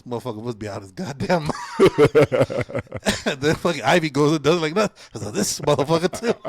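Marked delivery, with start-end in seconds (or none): 1.6-3.2 loud laugh at his own punchline; laughing loudly at his own punchline about the coach. Real, not acted. 5.3-6.4 laughed through, a bit louder; half-laughing, can't believe it; laughing in disbelief as Ivy does the bump too. Retold or imitated.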